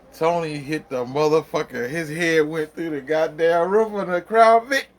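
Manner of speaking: high, loud, laughing through the words; barely holding back laughter; laughing retelling. Real, not acted. Telling friends the car story, laughing hard.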